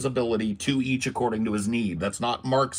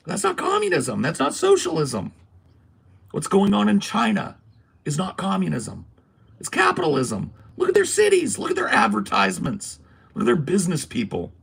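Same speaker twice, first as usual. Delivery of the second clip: much higher and louder, emphatic outburst; heated rant; 8 semitones higher, 5 dB louder than usual. Real, not acted. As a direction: hammer home each claim with rising heat. Heated rant, pitch jumps.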